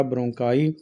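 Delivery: normal talking voice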